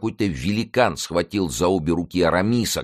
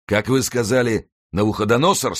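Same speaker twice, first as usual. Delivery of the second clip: raised question through a nasty smile; menacing smile; 7 semitones higher, 3 dB louder than usual. A narrator's reading. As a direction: ask it high, with sinister relish. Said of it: "спросил Мардаунт с ужасной улыбкой". (с ужасной улыбкой, повышенным тоном)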